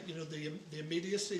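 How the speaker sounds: usual speaking voice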